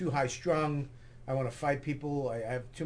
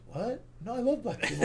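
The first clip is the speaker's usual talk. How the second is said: acting out taken aback; retold flustered denial; 7 semitones higher than usual. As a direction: protest your innocence, pitch jumping up.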